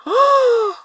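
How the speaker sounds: sharp high gasp; frightened gasp. Acted. Startled gasp.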